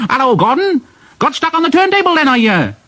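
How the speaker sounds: high, quick jeering; cheeky engine, gleeful and rapid; mocking James. A narrator's reading. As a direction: taunt a rival in a high-pitched voice.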